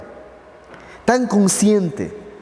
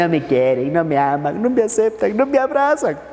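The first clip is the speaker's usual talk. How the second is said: mock whining. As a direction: whine like someone feeling sorry for themselves, rising. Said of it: "andamos llorando". (quejido lastimero imitado que sube de tono)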